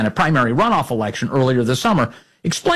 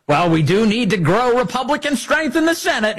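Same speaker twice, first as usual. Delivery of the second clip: mock Cornyn claim; 9 semitones higher than usual. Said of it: "he claims".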